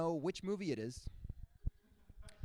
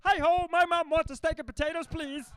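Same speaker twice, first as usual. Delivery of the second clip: high, loud Kermit the Frog voice; 13 semitones higher, 7 dB louder than usual. Retold or imitated. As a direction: chirp it like Kermit. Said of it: "learned it mostly from Muppets".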